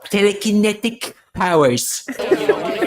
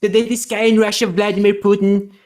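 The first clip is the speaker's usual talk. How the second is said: Trump impression. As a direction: brag like Trump at a rally. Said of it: "And now Trump's gonna climb in Santa's sleigh".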